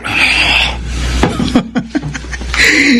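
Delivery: high, dismissive laugh. Acted. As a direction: laugh off the warning. Son brushing off father's warning.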